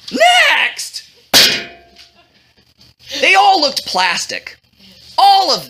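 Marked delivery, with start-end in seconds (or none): 0.1-1.0 very high, squawked dismissal; exasperated call. A narrator's reading. Bored king rejects yet another girl, exasperated. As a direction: squawk it as patience runs out.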